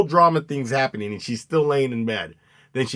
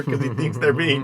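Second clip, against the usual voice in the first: ordinary talk, nothing marked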